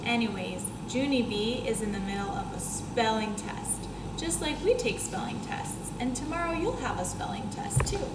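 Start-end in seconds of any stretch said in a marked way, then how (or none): none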